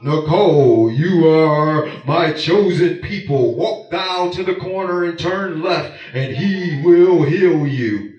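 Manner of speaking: deep, loud, slow divine decree; booming voice of God. Pretend. Preacher parodying a thundering God who calls Nicole. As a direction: thunder it like a voice from heaven. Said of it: "God ain't talking like that".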